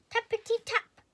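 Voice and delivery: high, loud tapping words; knocking sound. A narrator's reading. Narrator sounding out a knock at the door, high and loud. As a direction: make the knock sound spooky.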